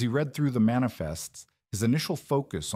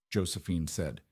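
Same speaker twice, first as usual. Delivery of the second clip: usual speaking voice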